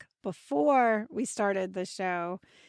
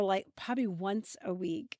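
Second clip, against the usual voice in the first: usual speaking voice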